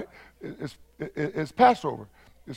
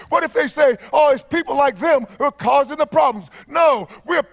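higher, forceful, insistent preacher voice; full-force preaching; 5 semitones higher, 4 dB louder than usual. Real, not acted. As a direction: drive each phrase home to the congregation.

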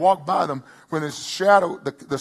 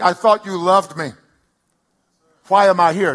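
anguished cry to God; 3 semitones higher, 7 dB louder than usual. Retold or imitated. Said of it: "I said things like".

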